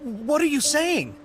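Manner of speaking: surprised. Acted. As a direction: ask it in shocked disbelief. Stunned by surprising orders.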